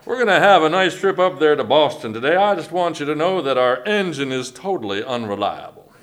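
folksy Southern pilot announcement; Southern airline captain. Acted. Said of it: "they're always, like, Southern".

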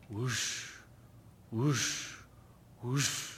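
quiet low breathy whoosh, imitating a machine; distant soft whoosh; soft whooshing sound. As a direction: hush out a faint whoosh. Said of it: "she heard this".

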